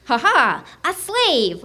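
lightly voiced gloating little alien; a hint of triumphant alien captor. A narrator's reading. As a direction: crow lightly over a catch. Alien captors gloat over their find.

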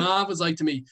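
normal talking voice

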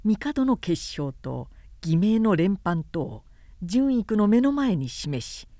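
ordinary delivery, nothing marked

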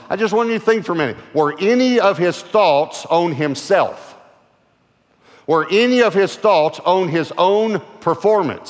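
loud, rising revival preaching; fiery preacher's call; preaching at full force. Acted. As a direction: preach it out to the crowd, building.

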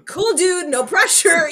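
high, loud sarcastic cheer; mock excited. Laughing to a friend, high and loud mock cheer. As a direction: hype it up with a laugh. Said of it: "And I was like".

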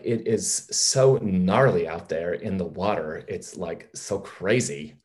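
slow, stretched-out Californian surfer talk; mock Californian drawl; Californian accent. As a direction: draw the words out like a laid-back Californian. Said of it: "What does a Californian sound like".

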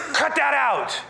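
high, sharp mock-royal outburst; exasperated king. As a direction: act out a king who has had enough. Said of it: "Enough to where Ahab goes".

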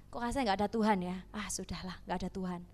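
quick, low mumble of giving up; soft, resigned letdown, as if giving up on praying; half-hearted seeker. Acted. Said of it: "Itu bukan mencari". (pasrah setengah hati, pelan dan rendah)

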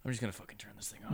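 fast unvoiced mutter, barely audible; muttered under breath. Real, not acted. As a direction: grumble it off quickly under your breath. Muttered threat mid-banter.

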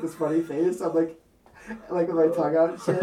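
giggling through the words; laughing. Real, not acted. Telling his friend, laughing at himself. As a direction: laugh while describing it. Describing the funny face he made.

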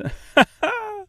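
very high cartoonish laugh; big bird's high cackle. A narrator's reading. Giant stork amused at Dorothy's fear, laughing high. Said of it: "It laughed".

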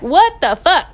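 mock outrage. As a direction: blurt it out, caught off guard. Her embarrassed retort to dad.

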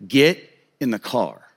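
imitated stern father, drawn out; no-nonsense dad. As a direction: spell the order out slowly, no argument. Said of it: "very no-nonsense, handle your business kind of guy".

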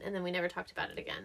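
quick whispered aside. Real, not acted. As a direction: drop to a whisper and hurry it. Joking aside about awkward topic.

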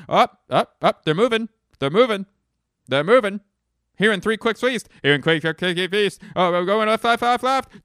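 high, loud staccato crew alarms; hammed-up lookout yelling updates; frantic movie impression. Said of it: "here's my impression of".

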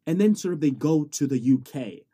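normal talking voice

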